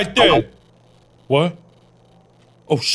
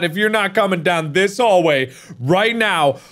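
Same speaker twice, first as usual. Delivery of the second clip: usual speaking voice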